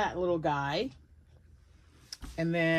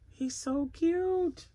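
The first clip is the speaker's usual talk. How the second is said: much higher and slow, doting; cooing; 10 semitones higher, much slower than usual. Real, not acted. Cooing over the finished cat.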